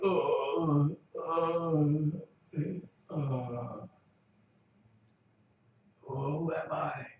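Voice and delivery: quiet, slow, dazed mumble; groggy waking man. Acted. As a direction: come to, confused. Unconscious NPC waking up.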